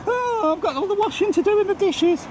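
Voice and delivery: very high, whiny put-on voice; squeaky caricature of a put-upon wife; mock moaning wife. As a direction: mimic the missus complaining about chores. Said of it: "She pisses and moans and says she's so busy".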